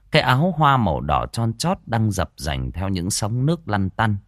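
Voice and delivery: lively village ghost-story telling; storyteller voice for a spooky folk tale; story narration. A narrator's reading. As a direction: draw the listener into the scene.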